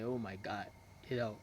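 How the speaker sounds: low, soft awed murmur; quiet wide-eyed admiration; awestruck murmur. Shown as a demonstration. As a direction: breathe it out as if stunned by her looks. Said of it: "this is the way a guy is supposed to act".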